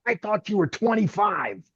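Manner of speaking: imitating a flustered, angry boss; retold exasperation. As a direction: throw it back at him, voice way up. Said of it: "And he stormed away".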